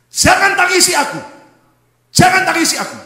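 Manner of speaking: very loud, high command; full-force preacher quoting Jesus; commanding proclamation. Acted. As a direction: thunder it as a firm order. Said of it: "Yesus berkata".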